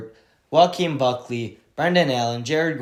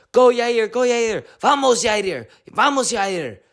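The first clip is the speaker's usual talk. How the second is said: high mock cheering of Mexican fight fans; mock crowd yell, pitched up; imitated fans screaming; 10 semitones higher, slower than usual. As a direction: chant it high like an excited crowd. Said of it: "They're all screaming in Mexican".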